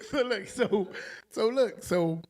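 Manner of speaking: loud words still shaking with laughter; laughing through words. Real, not acted. Trying to continue the story while laughing, loud.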